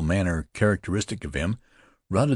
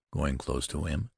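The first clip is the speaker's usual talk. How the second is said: usual speaking voice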